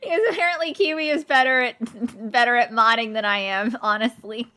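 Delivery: high, loud, talking through laughter; laughing. Real, not acted. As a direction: squeeze it out while cracking up. Laughing right after laugh.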